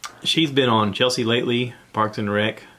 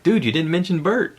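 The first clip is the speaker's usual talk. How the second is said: tag; 9 semitones higher, 4 dB louder than usual. Retold or imitated. Retold complaint from a listener.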